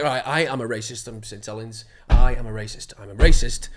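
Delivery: loud self-psych chant; loud mantra. Retold or imitated. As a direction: pump yourself up, loud. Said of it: "I was just like".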